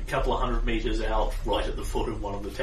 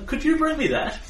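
much higher polite request; high wizard voice; 4 dB louder than usual. Acted. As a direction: ask sweetly in a high voice. Wizard asks servant.

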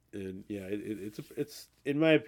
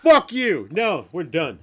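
playful furious shout, much higher and louder; mock outrage; 7 semitones higher, 13 dB louder, slower than usual. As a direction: yell it in fake fury at a friend. Mock outrage at friend's answer.

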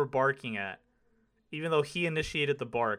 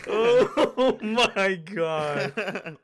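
slow, loud gasp through laughter; laughing in disbelief; real laughter; 7 semitones higher, 8 dB louder, much slower than usual. Real, not acted. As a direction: barely get it out for laughing. Host cracking up at joke.

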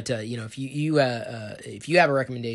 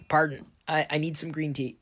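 normal talking voice

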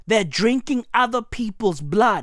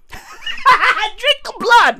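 laughing command; 11 semitones higher, much slower than usual. Real, not acted.

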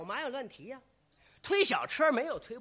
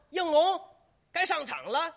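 raised call to the actor; stage manager's call; 5 semitones higher, 4 dB louder than usual. Acted. Stage manager calling the next actor on, loud cry. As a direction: call out an entrance cue. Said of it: "管事的一嚷".

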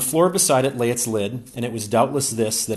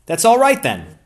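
cheerfully; 8 semitones higher, 11 dB louder than usual. A narrator's reading. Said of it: "said Ransom cheerfully".

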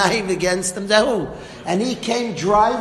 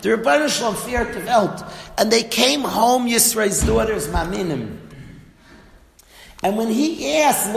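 ordinary delivery

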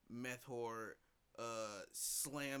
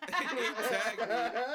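loud, slow laughing recall; 10 semitones higher, 11 dB louder, much slower than usual. Real, not acted. Telling friends about being tagged, laughing loudly. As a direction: laugh through the words.

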